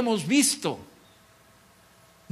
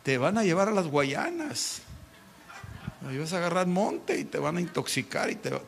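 soft, teasing mock warning to the mother-in-law; 6 dB quieter than usual. Young man mocking his mother-in-law's preaching, softly teasing. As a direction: scoff at her faith softly. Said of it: "Yo me reía de mi suegra".

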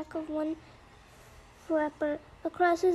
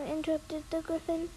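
ordinary delivery, nothing marked

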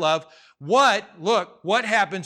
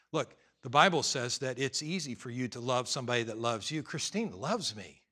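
ordinary talk, nothing marked

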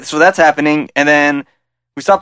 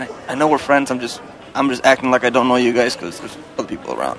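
lowered, softer voice; confidential aside; 3 semitones lower, 6 dB quieter than usual. Acted. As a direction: keep it between friends. Confidential aside in restaurant scene.

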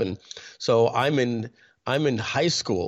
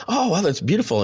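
higher-pitched, imitated impressed reaction; admiring delight; 8 semitones higher than usual. Dealership staff admiring dad's French accent, imitated delight. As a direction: gush lightly, as a charmed listener.

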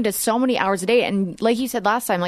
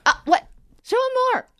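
excited. Retold or imitated. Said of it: "I'm like".

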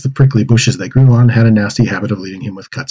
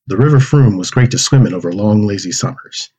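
plain reading voice

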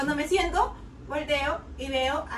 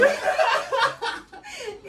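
real laugh; 12 semitones higher, 5 dB louder than usual. Real, not acted. Laughing at the story.

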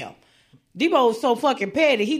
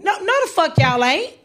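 normal talking voice

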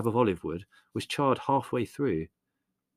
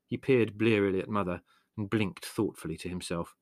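plain reading voice

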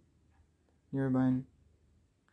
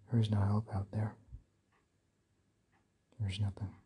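whispering; 2 semitones lower, 3 dB quieter than usual. Real, not acted. Hopeless quiet conclusion.

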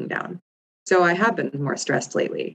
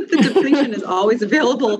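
higher voice breaking into laughter; laughing; 9 semitones higher, 4 dB louder than usual. Real, not acted. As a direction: laugh through the words, amused at yourself. Laughing at own admission of depletion.

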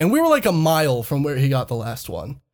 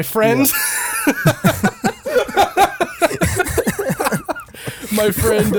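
laughing; 10 semitones higher, much slower than usual. Real, not acted. Laughing while starting story.

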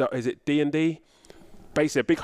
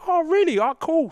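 mock surprise; 14 semitones higher than usual. Retelling his sarcastic reply, mock surprised. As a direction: overplay being impressed.

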